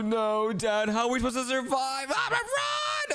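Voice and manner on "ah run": high shouted run; frantic command, much higher; panicked yell. Real, not acted. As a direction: yell to flee. Fleeing monster.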